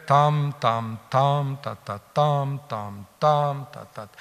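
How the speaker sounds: hexamètre battu en syllabes: « tam » longs, « ta, ta » brefs; rythme d'hexamètre. Shown as a demonstration. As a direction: battre la mesure, lentement et régulièrement. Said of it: "il y a un rythme dans l'hexamètre". (« tam » longs et « ta, ta » brefs, scandés en mesure)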